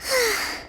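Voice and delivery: a long tired exhale; weary sigh. Acted. Leader relaxing once the crisis is over, weary sigh. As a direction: sigh the strain out. Task done, energy fading.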